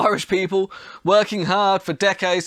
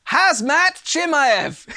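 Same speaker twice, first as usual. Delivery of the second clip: excited meme reveal; 10 semitones higher, 4 dB louder, much slower than usual. Acted. Reading out a favourite meme.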